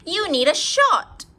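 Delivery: a high, loud little doctor pronouncing; doctor Ted. Acted. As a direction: announce it like a proud kid doctor. Ted prescribes to principal.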